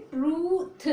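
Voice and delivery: high "ट्रूथ" with long oo, th apart; sounded out. Shown as a demonstration. Teacher answers own drill question, sounded out "ट्रूथ". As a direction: hold "ट्रू" and release th separately. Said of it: "क्या बोलोगे".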